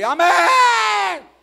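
long, high shouted amen; grito. Retold or imitated. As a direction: yell it out at full force, imitating a shouter. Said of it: "él grita ahí". (amén gritado, agudo y alargado)

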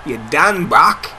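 ordinary talk, nothing marked

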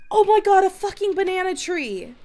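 loud, high burst of real excitement; excited shouting. Real, not acted. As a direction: yell with pure joy at the banana tree. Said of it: "excited, buddy".

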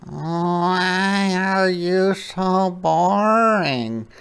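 high, very slow anteater voice; teasing anteater. Acted. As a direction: draw out the question in a high put-on voice. Anteater voiced in read story.

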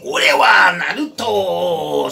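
slow, loud brash "Ore wa Naruto". Retold or imitated. Teacher imitating Naruto's rude intro, slow and loud. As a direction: shout it like a cocky anime hero. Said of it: "O Naruto chega e fala assim".

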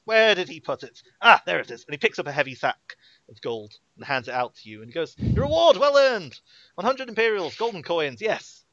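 put-on a gushing boy-lord thrilled with his heroes; young duke greets the adventurers, high and loud; eager young duke. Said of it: "and he stands up and he goes".

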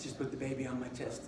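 soft, low, unruffled wife; super calm. Retold or imitated. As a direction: say it very quietly and calmly. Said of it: "like, this super calm".

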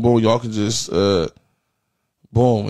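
ordinary talk

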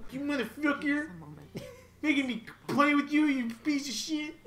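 a hint of a little kid swearing. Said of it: "That's my kid voice".

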